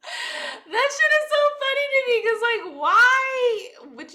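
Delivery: very high, loud, tickled squeal; cracking up. Real, not acted. Telling viewers the court clip is hilarious, high-pitched amusement. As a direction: squeal it out, stretching the last words.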